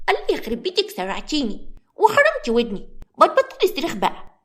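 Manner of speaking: put-on a four-year-old scolding crossly; irritated little girl. Little Layan scolds the screaming Samra in a high, cross voice. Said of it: "بتقول لها بعصبية".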